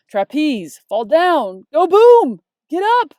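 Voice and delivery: loud high punched-out iambs; big exclaimed two-beat bursts; poem performance. Shown as a demonstration. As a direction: belt each pair, hitting the second beat hard. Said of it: "I made a little iambic pentameter poem for you".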